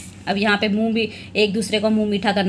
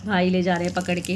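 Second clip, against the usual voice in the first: usual speaking voice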